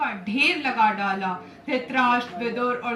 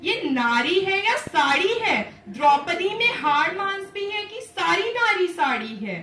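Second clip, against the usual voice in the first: higher, wondering questions; astonished; 6 semitones higher, 5 dB louder than usual. A narrator's reading. Dushasan baffled by the endless sari, astonished. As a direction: puzzle aloud in swooping, amazed questions. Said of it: "था बहुत अचंभित दुशासन".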